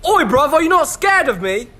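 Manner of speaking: high, loud mock British ghost; a hammed-up British accent, pitched high. Ghost surprised the kid isn't scared, in a high mock British accent.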